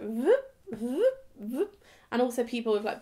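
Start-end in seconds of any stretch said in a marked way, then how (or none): 0.0-1.3 imitated sliding door whoosh; door noise. Describing the train toilet door, imitated whoosh. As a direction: mimic a curved door swinging. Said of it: "a curved door that goes, like".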